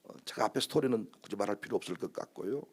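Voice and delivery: normal talking voice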